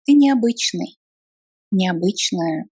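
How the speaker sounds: ordinary talk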